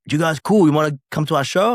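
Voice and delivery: lightly voiced band member; friendly invite. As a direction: offer tickets warmly.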